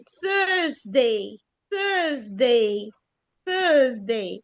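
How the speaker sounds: Thursday as THUZ-day, no R sound; Thursday without R. Shown as a demonstration. Thursday للطلاب بدون R. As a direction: model Thursday slowly, dropping the R. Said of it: "راحت فين ال R؟".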